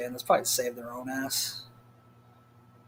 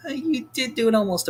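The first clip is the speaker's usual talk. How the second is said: higher mock voice; a teasing reminder pitched up; imitating Tracy; 9 semitones higher than usual. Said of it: "It's like".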